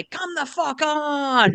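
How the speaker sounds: put-on English accent, high and dragged out, laughing; exasperated Brit, drawn out with a laugh. Imitating English fans at a dive, English accent, slow. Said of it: "as the English say".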